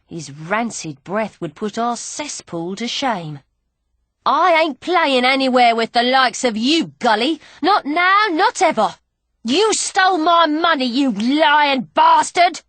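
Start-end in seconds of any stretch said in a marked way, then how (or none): 4.3-12.6 loud, high yelling at a thief; furious shout. Acted. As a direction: throw it in his face. Angry quarrel over stolen money.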